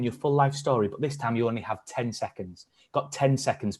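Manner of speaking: usual speaking voice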